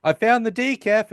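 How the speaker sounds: high, singsong retold line; imitating Penny. Recapper quoting Penny to co-host about the decaf, high-pitched imitation. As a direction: lift it up high, quoting her.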